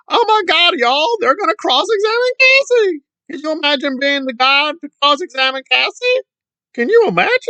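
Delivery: high, squeaky fake-crying voice; mock tearful hysteria. Commentator mocking someone crying about Cassie, hammed-up tearful shock. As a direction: overdo the scandalized, weepy disbelief. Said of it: "I just cried about Cassie".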